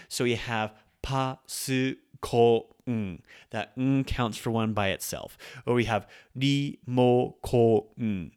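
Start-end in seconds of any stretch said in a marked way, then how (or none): 0.1-3.2 pa, su, ko, n spaced out slowly; pa su ko n one beat each; split into mora. Shown as a demonstration. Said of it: "four mora". 5.7-8.3 pa, su, ko, n spaced out slowly; pa su ko n one beat each; split into mora. Shown as a demonstration. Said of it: "four mora".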